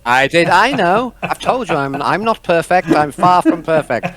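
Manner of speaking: high, loud self-deprecating admission; sheepish protest. Real, not acted. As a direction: own up loudly after being teased. Teased game master protests guiltily.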